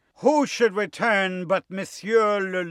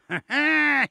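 growling wolf laugh, pitched up; growled laugh; 9 semitones higher, 4 dB louder than usual. Acted. Wolf overhears the sheep singing, growled laugh. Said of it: "he growled".

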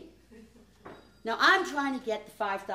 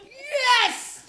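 much higher, loud "Yes!"; loud, high exclamation of stubborn certainty; defiant shout; 14 semitones higher, 9 dB louder than usual. Retold or imitated.

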